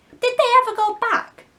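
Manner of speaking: put-on high, eager children's voice; curious kids hoping; Lost Boys asking. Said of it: "the Lost Boys asked".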